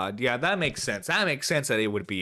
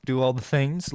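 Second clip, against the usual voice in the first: ordinary delivery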